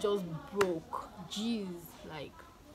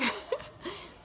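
breathy giggle; 12 semitones higher than usual. Real, not acted. Laughs at own joke.

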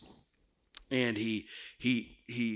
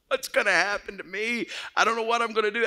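retold childhood panic; 9 semitones higher, 3 dB louder, faster than usual. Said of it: "fear is all over me".

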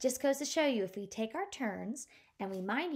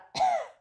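high sputtering engine cough; a car engine coughing out; 6 dB louder than usual. Acted. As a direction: cough like a dying motor. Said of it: "he gave a".

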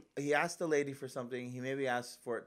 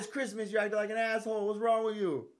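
scolding; 10 semitones higher, 4 dB louder than usual. Retold or imitated. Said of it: "I said".

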